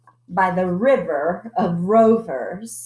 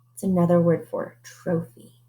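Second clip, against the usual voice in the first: ordinary delivery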